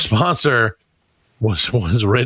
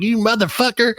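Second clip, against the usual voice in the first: put-on Southern-accented outburst, pitched up; heavy Southern accent; 8 semitones higher than usual. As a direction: curse in a mock-angry Southern accent. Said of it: "I say it with a real heavy Southern accent".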